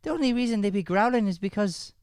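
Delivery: very high, squeaky character voice; high put-on character voice. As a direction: explain it in a high silly voice.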